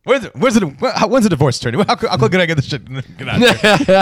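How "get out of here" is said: slow, laughing through the words; laughing dismissal. Real, not acted. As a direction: wave them off while cracking up.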